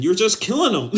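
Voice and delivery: high, loud, breaking into laughter; laughing incredulously. Real, not acted. Arguing it's no hard lesson, loud incredulous laughing. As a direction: protest it loudly, then crack up. Laughs at his own point.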